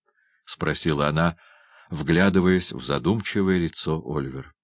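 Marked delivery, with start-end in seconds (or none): none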